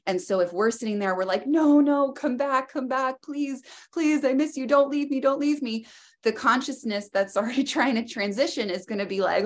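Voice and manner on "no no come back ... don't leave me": high, desperate begging to stay; imitating a frantic plea, pitched up; pleading. Family at the deathbed begging the father not to leave, pleading high.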